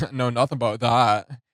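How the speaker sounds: giggling while mimicking the rapper's odd delivery; laughing Valley girl mimic. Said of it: "I don't know why he says it like this".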